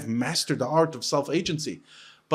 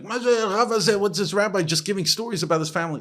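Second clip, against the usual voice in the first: higher, louder griping congregant imitation; put-on grumble, pitched up; mock complaint; 7 semitones higher, 3 dB louder than usual. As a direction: imitate an indignant heckler in the shul. Mimics a congregant's complaint about him.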